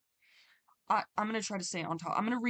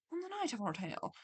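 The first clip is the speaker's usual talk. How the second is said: attempted Scottish accent, quieter; 3 semitones higher, 4 dB quieter than usual. Shown as a demonstration. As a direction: try a Scottish lilt on the story line. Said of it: "I can't do a Scottish accent".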